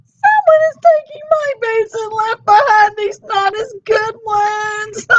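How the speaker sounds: put-on Baby Bear upset her boots are gone; mock wail. Baby Bear missing her boots, high mock wail. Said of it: "she wailed".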